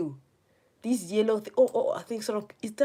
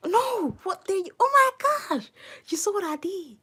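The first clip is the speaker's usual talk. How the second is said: high, loud shocked cries; 13 semitones higher, 6 dB louder than usual. Real, not acted. Gamer ambushed by snakes, high shocked shrieks.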